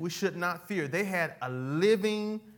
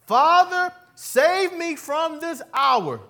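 high, loud pleading cry to the Father; desperate prayer shouted heavenward; anguished cry; 10 semitones higher, 10 dB louder, slower than usual. Acted. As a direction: cry out to God in anguish, drawing it out slowly. Preacher voices Jesus's anguished prayer.

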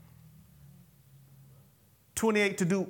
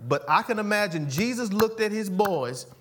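ordinary talk, nothing marked

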